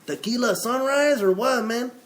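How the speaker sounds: high hammed-up Latin American accent; loud, high Latin American guy voice. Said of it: "I should go with the Latin voice".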